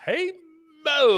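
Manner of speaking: high, loud mock British "Hey, Moan"; attempted British accent. Host trying a British accent on a fan's greeting. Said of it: "I can't do it in a British accent".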